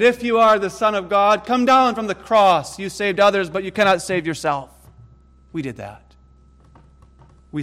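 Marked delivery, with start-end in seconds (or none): 0.0-4.7 loud, higher taunting call; mocking jeer. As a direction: sneer it up at the cross. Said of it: "who mocked him and said".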